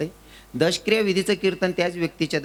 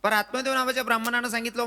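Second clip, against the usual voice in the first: ordinary delivery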